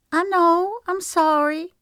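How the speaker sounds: put-on a tearful little girl saying sorry; little girl on the verge of tears. Agnes apologizes to Shelby, high tearful child voice.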